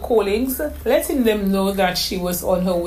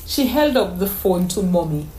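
ordinary delivery

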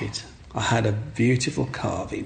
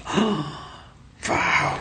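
awed gasp; 5 semitones higher than usual. Retold or imitated. Journalist sees the carving.

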